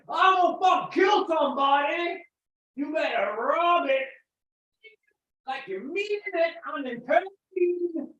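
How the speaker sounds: loud, high put-on character shout; hammed-up threat. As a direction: bellow it theatrically, stretching the words.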